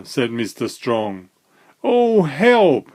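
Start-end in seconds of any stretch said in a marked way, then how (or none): 1.8-2.9 higher, louder panicky shriek; scared high cry; frightened shriek. A narrator's reading. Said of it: "shrieked Mister Jelly".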